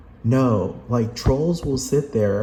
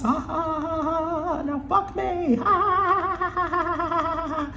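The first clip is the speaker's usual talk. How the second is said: very high, squeaky, cackling crazed voice; shrill mock madman. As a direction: squeal it all out like a giggling maniac. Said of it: "He went from this".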